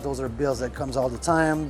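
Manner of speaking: ordinary talk, nothing marked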